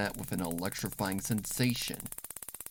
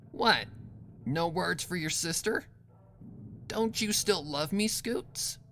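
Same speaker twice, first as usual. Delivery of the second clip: higher, teasing sister voice, ending in a breathy hiss; Rainbow Dash, mocking; 10 semitones higher than usual. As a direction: taunt her with false affection. Dash mocks her sister.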